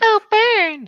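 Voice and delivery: high, loud heckle from the crowd; an audience member's loud jeering yell; calling out. Acted. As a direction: shout it from the cheap seats. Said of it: "someone called from within the audience".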